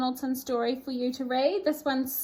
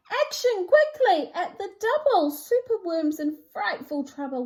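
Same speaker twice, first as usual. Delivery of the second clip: urgent rally; 6 semitones higher, 6 dB louder than usual. Acted. Creatures rally to help.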